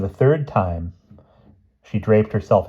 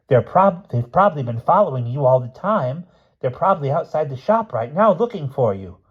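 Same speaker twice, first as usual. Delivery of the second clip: higher, lightly acted gentleman's voice; small gentleman, speculating; 7 semitones higher than usual. A narrator's reading. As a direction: reason it out with raised pitch.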